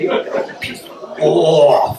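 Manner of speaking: slow, drawn-out royal "piss off"; "piss off" pulled long in a posh voice. Shown as a demonstration. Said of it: "drag it out from somewhere down here".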